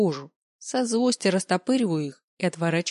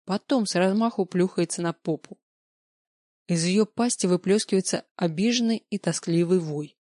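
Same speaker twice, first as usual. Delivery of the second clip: ordinary delivery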